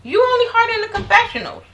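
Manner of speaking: mock high voice telling Jackie off. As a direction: talk down to her in a high taunt. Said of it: "I'm like".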